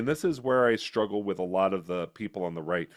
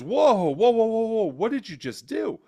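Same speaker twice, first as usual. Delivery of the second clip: loud, high alarmed cry of whoa; startled whoa; 12 semitones higher, 7 dB louder than usual. Retold or imitated. Husband shocked as wife throws meat away, alarmed high shout. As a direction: jump in shocked, much higher and louder.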